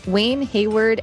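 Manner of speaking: ordinary talk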